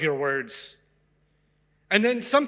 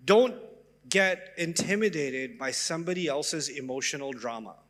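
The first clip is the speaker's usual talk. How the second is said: usual speaking voice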